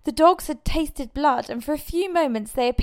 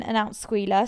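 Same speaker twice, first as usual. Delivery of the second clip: ordinary delivery, nothing marked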